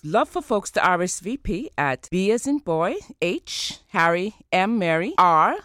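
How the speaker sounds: letter by letter, each letter called out loud; spelling it out. Real, not acted. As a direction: spell it out clearly for listeners. Spelling web address for listeners.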